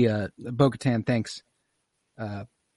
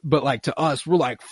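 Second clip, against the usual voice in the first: normal talking voice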